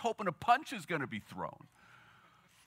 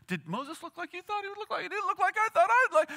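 pitched up high, tumbling excited questions; giddy retelling; 11 semitones higher than usual. Retold or imitated. Disciples heading down the mountain, gushing with high excitement. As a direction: gush like a starstruck fan.

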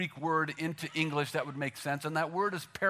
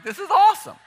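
shouted with delight, much higher and louder; a mock cheer at the dinner party; excited; 13 semitones higher, 11 dB louder, faster than usual. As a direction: yell it like a thrilled host. Friends delighted by multiplied food.

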